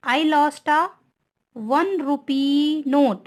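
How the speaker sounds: reads the sentence very slowly, word by word; slow dictation. Shown as a demonstration. Teacher dictating an example sentence to students, very slow. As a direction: spell it out slowly for the class. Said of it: "teacher reads example sentence slowly".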